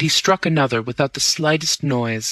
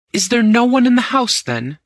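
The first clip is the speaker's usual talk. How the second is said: high, loud alarmed question; dismayed disbelief; 10 semitones higher, 4 dB louder than usual. A narrator's reading. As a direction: hoog en luid, ontzet. Dismayed realization in empty house.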